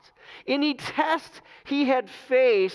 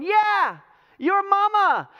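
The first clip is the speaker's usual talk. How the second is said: high, loud comeback taunt; mock taunt yelled back; 10 semitones higher, 5 dB louder, slower than usual. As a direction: fire the insult back across the valley. Armies yelling insults back and forth.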